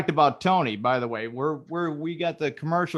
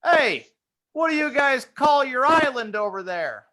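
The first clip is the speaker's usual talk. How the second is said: high, loud holler to the shore; screamed across water; 10 semitones higher, 5 dB louder than usual. Acted. Italian in a boat hailing the shoreline, screamed. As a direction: call out loudly from a boat. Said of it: "he screamed over to the shoreline".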